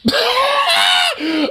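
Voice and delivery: a high burst of shrieking laughter. Real, not acted. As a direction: explode into a high screaming laugh. Reacting to absurd clip.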